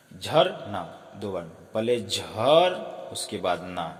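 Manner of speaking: झर and ना spoken very slowly, apart; slow syllable split. Shown as a demonstration. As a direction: spell out the syllables slowly for children. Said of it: "इसको भी उच्चारण करिए".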